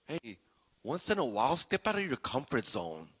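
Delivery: low, soft, confiding voice; quiet invitation. Acted. Jesus invites listeners out of comfort, quietly. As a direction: lean in softly, as a private nudge.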